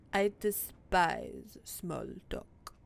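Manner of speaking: slow, disdainful bad French accent; haughty mock-French cat voice. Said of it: "Frou Frou has a French accent".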